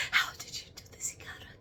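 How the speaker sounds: soft unvoiced whisper of wonder. Real, not acted. Medium greeting a remembered spirit, whispering in awe. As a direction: hush it like a secret.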